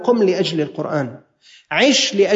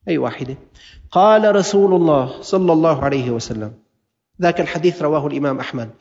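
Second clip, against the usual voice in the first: ordinary talk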